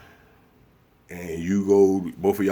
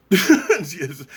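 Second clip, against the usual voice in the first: laughing yes; 10 semitones higher, 5 dB louder than usual. Real, not acted. Laughs at own impression.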